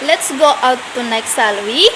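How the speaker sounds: "shall we" climbs, making a suggestion; rising tag intonation. Shown as a demonstration. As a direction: swing "we" upward as an invitation. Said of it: "memberikan saran dengan intonasi yang naik".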